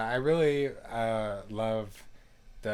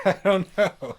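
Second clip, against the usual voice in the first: laughing; 9 semitones higher, 13 dB louder than usual. Real, not acted. Gives up explaining, laughs.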